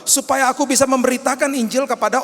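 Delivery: loud, high, fervent preaching; preaching at full force. Acted. Pastor proclaiming Paul's teaching to the church, fervent. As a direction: drive the point home to the congregation.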